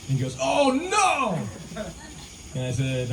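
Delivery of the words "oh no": high alarmed yelp from the friend; panicked cry. Retold or imitated. Friend in the ocean realizing the keys are gone, high panicked yelp. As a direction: squeal it out in sudden alarm.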